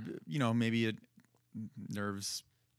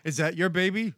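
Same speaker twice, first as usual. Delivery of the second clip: mock Don Rickles; 5 semitones higher, 5 dB louder than usual. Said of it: "Don Rickles machine".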